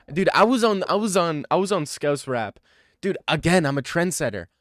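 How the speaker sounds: loud protest. Real, not acted. Streamer defending his taste to chat, loud protest. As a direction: argue back hard.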